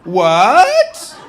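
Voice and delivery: loud, high, hammed-up shock; mock disbelief. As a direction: overplay the incredulity, swinging up hard at the end. Mock reading of many question marks.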